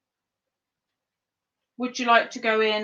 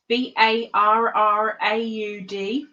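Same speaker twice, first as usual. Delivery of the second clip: letter by letter, slow and careful; spelled out slowly; slower than usual. Shown as a demonstration. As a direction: spell each letter deliberately. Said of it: "I will spell the name out".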